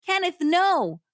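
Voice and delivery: high, loud Southern plea; acted desperate no; protesting cry. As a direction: cry out to hold him back. Wife protests during phone scuffle.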